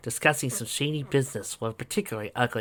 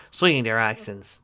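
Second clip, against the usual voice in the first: usual speaking voice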